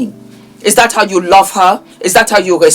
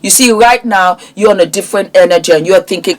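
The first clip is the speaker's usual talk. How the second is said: ordinary talk, nothing marked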